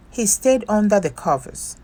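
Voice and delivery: ordinary delivery, nothing marked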